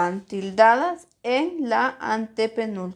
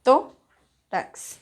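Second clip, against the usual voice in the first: "Tórax" split as TÓ... rax, first syllable punched; stressed first syllable; 3 semitones higher than usual. Shown as a demonstration. Teacher showing where the voice is strongest in "Tórax", stressed and split. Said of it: "¿Dónde tiene la mayor fuerza de voz?".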